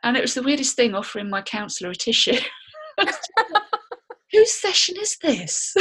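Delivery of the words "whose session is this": high, loud, slow through laughter; helplessly amused, pitched right up; laughing. Real, not acted. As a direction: crack up at your own punchline. Joking about comforting her counsellor, laughing.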